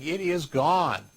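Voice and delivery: three slow weighted words; slow, firm. Shown as a demonstration. Trainer tells staff the bad dish must go, slow and firm. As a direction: land it like a verdict. Emphatic rule for servers.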